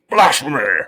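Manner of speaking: loud, high indignation; outraged cry. A narrator's reading. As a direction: burst out, scandalised. Humbug quarrels with the bee.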